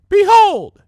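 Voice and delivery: high, loud triumphant cry; grand behold. Acted. Beauty queen showing her makeover to the crowd, loud. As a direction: unveil your work to the crowd. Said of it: "said the beauty queen".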